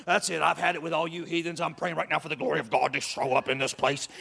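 lightly voiced pompous zealot; mock self-righteous prayer warrior. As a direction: ham up a preachy coworker condemning the heathens. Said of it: "show up at the office and go".

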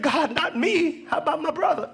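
high, mock-pleading protest; pleading dodge. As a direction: beg off and offer someone else instead. Dodging God's call, acted out.

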